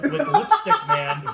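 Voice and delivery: says the name through a burst of laughter; high and cracking with laughter; laughing. Real, not acted. Laughing while naming villain.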